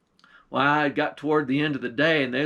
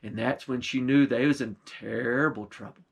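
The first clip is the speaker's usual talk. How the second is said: ordinary delivery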